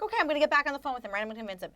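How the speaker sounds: fast, high, chirpy go-getter. Retold or imitated.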